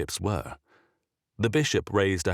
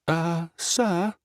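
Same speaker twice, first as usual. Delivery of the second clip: timid servant; 12 semitones higher than usual. A narrator's reading.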